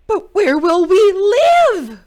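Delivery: high, loud, slow pleading; worried cry. A narrator's reading. Animals begging Jim where to live, high plea. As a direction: plead in alarm. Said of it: "cried the animals".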